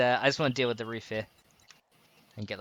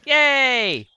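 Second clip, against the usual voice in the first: high, loud yay; cheering; 10 semitones higher, 6 dB louder than usual. Pretend. Cheering his co-player's messy paint, playfully excited. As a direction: give a playful drawn-out cheer.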